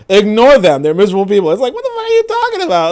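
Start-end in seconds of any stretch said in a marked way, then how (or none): 0.1-1.5 louder, mocking echo of the mother; mock consoling mother. Streamer mocking the mother's advice, louder. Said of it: "and America's like". 1.7-2.9 way higher, fast and incredulous; disbelief. Real, not acted. Streamer reacting to the clip, much higher and incredulous.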